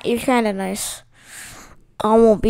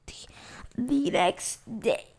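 whispering; 12 dB quieter than usual. Shown as a demonstration. Rereads it whispered.

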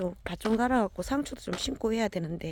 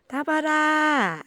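high, slow sing-song call to the dog; long high dog-calling call; 9 semitones higher, 4 dB louder, much slower than usual. Real, not acted. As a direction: draw the name out high and loud to call him over.

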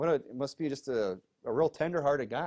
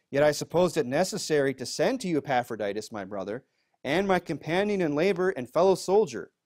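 raised, louder Bible-reading voice; scripture reading; 4 semitones higher, 5 dB louder than usual. Acted. Pastor reading scripture to the congregation, raised voice. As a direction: read the verse out with weight to the room. Reads verse twenty-five aloud.

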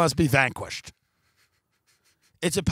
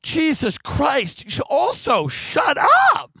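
high, loud fed-up yell; fed up with the other side, yelling at them; exasperated shout; 8 semitones higher, 3 dB louder, slower than usual. Retold or imitated. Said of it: "you're like".

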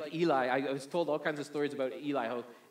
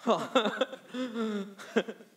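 real laugh, higher pitched; 6 semitones higher, 4 dB louder than usual. Real, not acted. Speaker amused at his botched accent, laughing. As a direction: laugh at your own failed impression.

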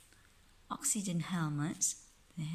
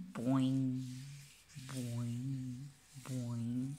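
lower, playful bounce noises; bouncing boings; 4 semitones lower, much slower than usual. Pretend. Parent making bouncing sounds for the astronaut, low and playful.

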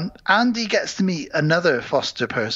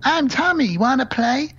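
high chirpy talking-doll voice, much higher; creepy toy doll; 10 semitones higher than usual. Retold or imitated. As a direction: chirp it like a pull-string toy.

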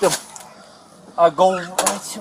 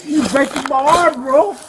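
arm twisted back; 7 semitones higher, 4 dB louder than usual. Real, not acted. Arm twisted back, louder plea.

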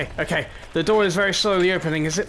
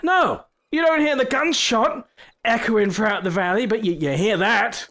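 higher, incredulous calling out; exasperated challenge; 6 semitones higher, 3 dB louder than usual. Pretend. Player questions zombies about the gunshot, mock exasperated. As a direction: call out in mock disbelief.

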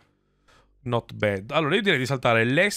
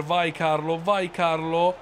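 drawn-out urging; 4 semitones higher, slower than usual. Real, not acted.

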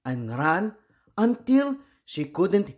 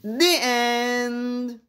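story ending; 9 semitones higher than usual. A narrator's reading. Closing the story.

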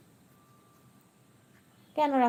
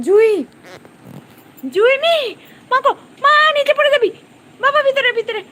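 high, loud panicked cries; alarmed shouting; 14 semitones higher, 15 dB louder than usual. Real, not acted. Owner to Jui at the window ledge, panicked shouting. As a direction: shout a frantic warning to pull them back inside. Pet about to fall from window.

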